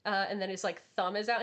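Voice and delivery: ordinary delivery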